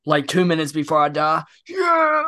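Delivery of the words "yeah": high, loud pretend victory yell; mock cheer. Fan imagines cheering two minutes before dying, mock cheer. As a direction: play-act a big cheering shout.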